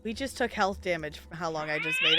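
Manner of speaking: normal talking voice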